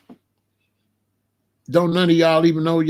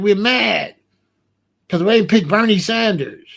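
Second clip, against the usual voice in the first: higher-pitched imitation of an angry daughter; mad daughter; 4 semitones higher, 4 dB louder than usual. Daughter telling Dad about the election, mad. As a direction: complain indignantly as the upset daughter. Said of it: "She was mad".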